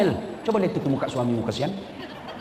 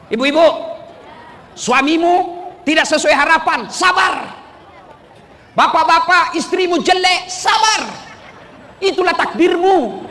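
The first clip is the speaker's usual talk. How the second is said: much higher, shouting sermon voice; full-force preaching; 11 semitones higher, 5 dB louder, slower than usual. Acted. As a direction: preach it loud to the crowd. (khotbah berapi-api dengan suara lantang)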